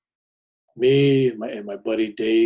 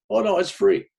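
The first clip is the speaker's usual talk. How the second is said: mock hustler reassurance; 9 semitones higher, 8 dB louder than usual. Hustler insisting it's free.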